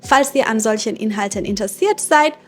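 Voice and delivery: normal talking voice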